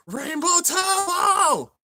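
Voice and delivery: high, slow, drawn-out shout of the move name; stretched battle cry. Retold or imitated. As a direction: belt it like an anime attack call.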